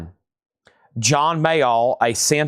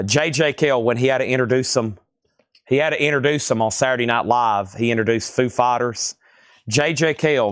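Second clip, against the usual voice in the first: ordinary talk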